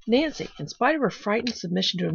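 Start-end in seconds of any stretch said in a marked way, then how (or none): none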